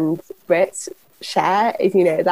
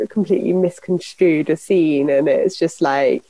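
normal talking voice

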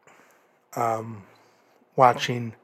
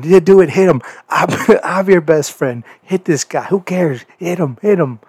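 higher, louder mock yelling; egging on; 6 semitones higher, 5 dB louder than usual. Roderick Strong urging Cole to hit MJF, higher mock yells. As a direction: egg him on like a heckler at ringside. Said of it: "Roderick Strong ... going".

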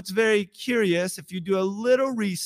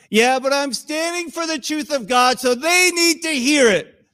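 raised pitch, mock indignant zealot; self-righteous insistence; 6 semitones higher, 3 dB louder than usual. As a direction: insist like a righteous truth-teller. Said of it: "with how you're saying it".

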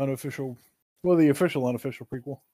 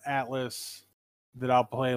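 ordinary talk

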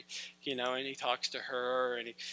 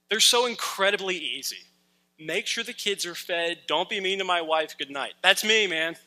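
mock boast; 3 semitones higher, 9 dB louder than usual. Mocking his own easy life standards.

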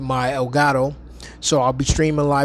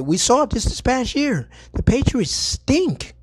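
mocking voice; 10 semitones higher than usual. Mocking the Patriots.

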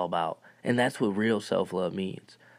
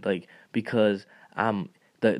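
usual speaking voice

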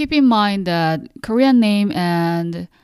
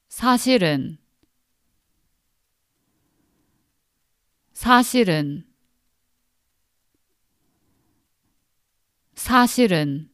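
사실은 said quicker, at natural speed; 사실은 at a brisker natural pace; faster; much faster than usual. Shown as a demonstration. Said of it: "Now faster, three times".

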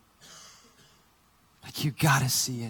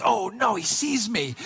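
mock alarm; 6 semitones higher than usual. Caught by driver at window.

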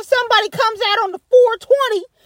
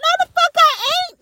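sharp, higher-pitched refusal; defiant; 7 semitones higher than usual. Real, not acted. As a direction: snap back the refusal in disbelief.

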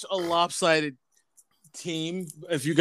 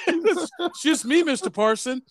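mocking boy voice; 11 semitones higher than usual. Co-host mimics boy to girlfriend's father, mocking. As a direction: mimic a cocky kid in a squeaky pitch.

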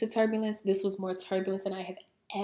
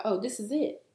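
panicked laughing; 7 semitones higher, 5 dB louder than usual. Retold or imitated. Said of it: "I thought".

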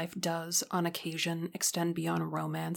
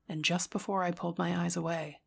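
ordinary delivery, nothing marked

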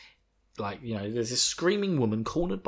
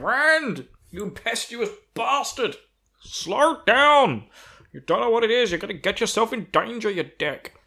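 much higher, loud scolding shout; Loyal's voice; 11 semitones higher, 6 dB louder than usual. Acted. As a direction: yell after a reckless friend. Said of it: "I really like that voice for Loyal".

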